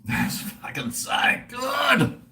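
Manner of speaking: high, drawn-out exclamation of delight; ecstatic relish. Real, not acted. Streamer savouring a blended rum drink, ecstatic relish.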